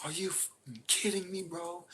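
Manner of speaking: high strained imitation of shocked friend; stunned disbelief. Roommate staring at his jacked car, high shocked disbelief. Roommate sees the missing tire.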